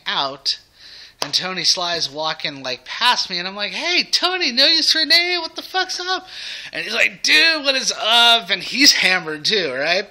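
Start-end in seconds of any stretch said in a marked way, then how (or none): 3.7-6.3 put-on very high, loud drunken yell across the room; drunken shouted greeting. Fan hollering at [name] passing by, very high drunken yell. 7.2-8.5 slow, loud, higher hammered bro greeting; drunken loud reply. Retold or imitated. Tony answering the drunk fan, slow loud higher greeting.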